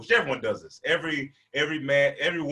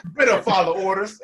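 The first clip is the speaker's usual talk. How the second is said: loud, higher, laughing bravado; playing it off loudly; 8 semitones higher, 8 dB louder than usual. Kid pretending he wanted the book thrown, loud bravado. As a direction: shout it to save face. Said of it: "I was like, I tried to play it off".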